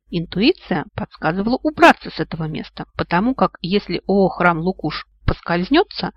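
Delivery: usual speaking voice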